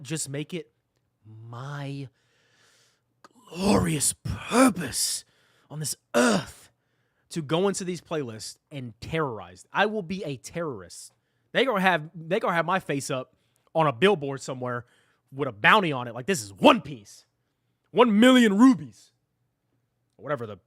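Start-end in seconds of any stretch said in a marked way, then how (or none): 1.5-6.7 loud, drawn-out words with heavy weight; grandiose mock proclamation. Streamer vowing to terrorize casual playlists, grandiose. 16.3-19.1 loud, booming anime announcement; mock bounty proclamation. Streamer announcing his own One Piece bounty, hammed-up shout.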